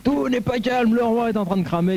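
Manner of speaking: high shouted alarm call; town crier yell. Acted. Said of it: "tu vas hurler".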